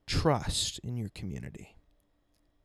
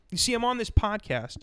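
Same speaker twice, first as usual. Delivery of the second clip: usual speaking voice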